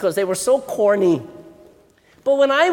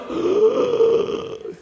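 shocked gasp; 12 semitones higher than usual. Retold or imitated. Shocked at drill in wall.

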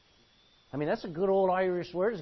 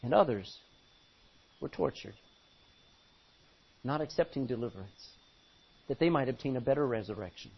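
low, hushed Bible reading; grave, softened pulpit voice; solemn scripture reading; 6 semitones lower, 4 dB quieter than usual. Real, not acted. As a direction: let the suffering sink in. Reads of the tortured, solemnly.

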